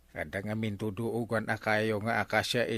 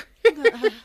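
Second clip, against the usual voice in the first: laughter; 14 semitones higher, 8 dB louder than usual. Acted. Laughs at talk of money.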